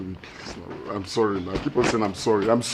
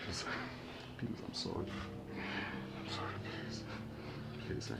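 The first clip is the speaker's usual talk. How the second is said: softer and lower begging, down to near-whispers; fading plea; 4 semitones lower, 5 dB quieter than usual. Acted. Husband begging his wife, fading into whispered apologies. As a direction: plead until the voice almost disappears.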